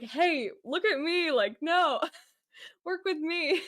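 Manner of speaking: high, playful pushy pitch with laughter; Loki. Retold or imitated. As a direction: mimic a needy trickster god, laughing. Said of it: "That was kind of the vibe".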